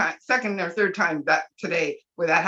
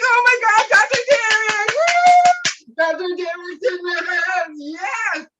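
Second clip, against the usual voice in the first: high, loud squeal of fan excitement; excited cheering; 11 semitones higher, 6 dB louder, slower than usual. Retold or imitated. Fan greeting Doctor Derek on screen, excited high shouting. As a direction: re-enact a wild whooping cheer. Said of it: "I was so excited".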